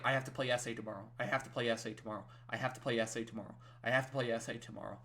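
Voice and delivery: low, fast, quiet looping mutter; hushed, rapid, repetitive inner voice; racing thoughts. Retold or imitated. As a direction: rattle it off under your breath like an anxious mantra. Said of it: "I remember my mind racing".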